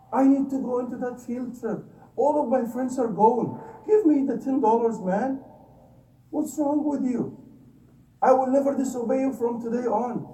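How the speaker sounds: mock whiny plea for the field-trip money; child begging dad. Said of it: "begging him until we cry".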